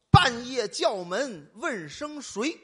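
each word drawn out slow and loud; slow riddle recital. Shown as a demonstration. To his partner, setting the riddle: slow and loud. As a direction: spell the riddle out slow for the audience. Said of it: "听我这个啊".

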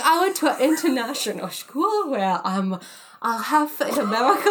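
put-on loud, high, garbled English accent; hammed-up botched English accent; failed English accent. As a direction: flounder through a fake English accent. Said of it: "And I couldn't do the accent".

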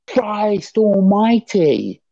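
high, long-drawn exasperation; exasperated outburst. Real, not acted. Coach ranting at bad coaching practice, exasperated. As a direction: cry out in real disbelief, stretching the words.

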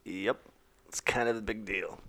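soft whisper, tongue in cheek; whispered mock brag. Real, not acted. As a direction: whisper it like a secret boast. Mock brag after modest claim.

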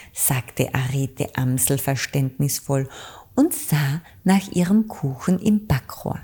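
usual speaking voice

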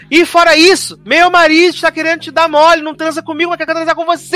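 high-pitched mock tirade; hammed-up quarrel voice; heated accusation. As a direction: act out an aggrieved wife laying into her friend. Acted quarrel, accusing her friend.

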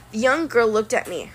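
ordinary delivery

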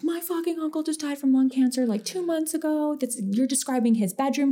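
high-pitched shock as she recognizes the room; freaked out. Retold or imitated. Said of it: "this girl freaks the fuck out".

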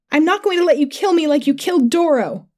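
high-pitched, defiant retort; shouting back. A narrator's reading. Attacker answering the man they stabbed, high defensive shout. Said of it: "I shouted back".

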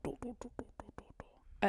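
breathy hushed monster foley imitation; whispered monster noise. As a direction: mimic a creepy movie creature sound under your breath. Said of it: "the Foley noise that they have for the monster".

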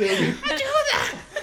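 very high laughing cry for help; hammed-up scream through laughter; mock scream.

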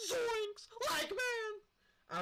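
high, loud cartoon panic scream; Shaggy. Friend retelling a scream on a drop ride, high panicked cartoon yell. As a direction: yell it out like a terrified cartoon slacker. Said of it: "screamed in Shaggy's voice".

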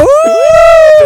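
high loud whoop of excitement; sudden high excited whoop. Real, not acted. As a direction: let out a big hype yelp. Hyping up two videos.

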